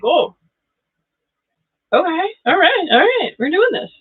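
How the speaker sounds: high, bright mock psyching-up; mock self-pep talk. Doubting reader retelling own reaction, high mock resolve. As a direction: talk yourself into it. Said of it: "I'm like".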